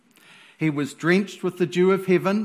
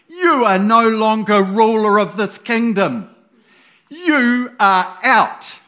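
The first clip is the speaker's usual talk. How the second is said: voice of God; 4 semitones higher, 6 dB louder than usual. Acted. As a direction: pronounce judgment from heaven. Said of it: "God just goes".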